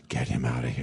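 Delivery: low, soft get him out of here; a low, hushed hint of Faye Dunaway; Faye Dunaway quote. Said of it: "Faye Dunaway turns around and says".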